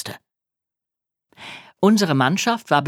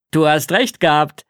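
louder, excited call to someone; raised voice, thrilled; 5 dB louder than usual. A narrator's reading. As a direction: call it over with delight. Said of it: "rief Papa mir zu".

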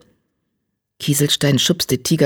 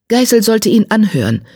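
higher, firm insistence; 7 semitones higher, 4 dB louder than usual. A narrator's reading. Grey warrior steps between two tomcats, firmly insistent. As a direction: press the point with conviction. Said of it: "sagte er bestimmt".

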